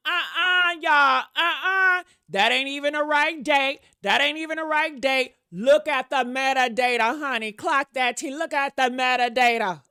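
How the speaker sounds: loud, insistent preacher-style objection; slick preacher. Acted. Mocking Diddy's defense to viewers, loud slick preacher. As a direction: object like a preacher working the congregation. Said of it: "Slicker than the preacher on an Easter Sunday".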